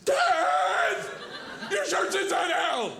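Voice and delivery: mock daughter yell. Daughter yelling at the mall.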